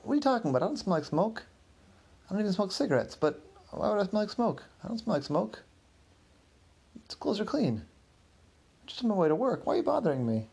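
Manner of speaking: high, indignant denial; defensive protest. Acted. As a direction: squeak out every denial, cornered. Clinton accused of smelling of smoke.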